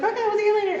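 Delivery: sister's goodbye. Retold or imitated. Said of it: "my sister's like".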